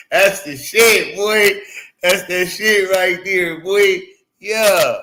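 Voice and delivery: loud, high, drawn-out praise; hyped approval. Real, not acted. Streamer cheering the bit he just performed, loud and drawn out. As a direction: stretch it out with loud delight.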